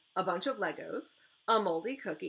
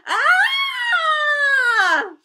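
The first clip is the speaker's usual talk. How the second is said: shrill high scream, much louder; piercing child's shriek of fright; 17 dB louder than usual. Acted. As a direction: let out a full terrified scream. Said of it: "My dad hears my scream".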